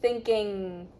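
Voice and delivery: "Thinking" ending on NG in the back of the throat; careful teacher's "Thinking"; model pronunciation. Shown as a demonstration. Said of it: "it ends in the back of your throat".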